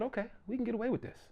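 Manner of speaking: quiet, fast scheming; hushed fast plotting; conspiratorial aside. Retold or imitated. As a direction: plot it under your breath. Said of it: "they said".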